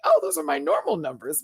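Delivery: high-pitched mock reaction; retold surprise. Host reacting to fifteen thousand views, high retold surprise. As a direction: pitch it way up, replaying your reaction.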